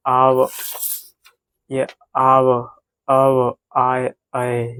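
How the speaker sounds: very slow, drawn-out sounding of each vowel; slow teacher demo breaking the word into sounds; slow syllable by syllable. Shown as a demonstration. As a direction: spell out the sounds slowly for the class. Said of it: "जब हम धीरे धीरे बोलेंगे".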